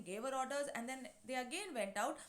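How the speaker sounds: ordinary talk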